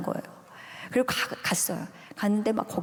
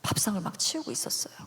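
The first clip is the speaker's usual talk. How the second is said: flustered whisper; 5 dB quieter than usual. Retold or imitated. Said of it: "저 혼자 너무 당황해서".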